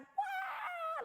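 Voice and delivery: high, drawn-out wail imitating frantic dogs; piercing mock howl; imitated dog noise. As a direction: mimic dogs going crazy with a long high "wah". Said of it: "My dogs are going".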